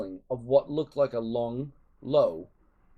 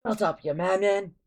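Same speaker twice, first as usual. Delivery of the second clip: snapping; 7 semitones higher, 3 dB louder than usual. A narrator's reading. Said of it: "snapped Fishlegs".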